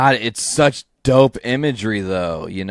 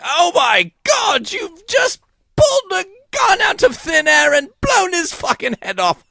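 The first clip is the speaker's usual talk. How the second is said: very high, shrieking, British-sounding Joker; Joker freaking out. Pretend. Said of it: "imagine Joker just, like, freaking out".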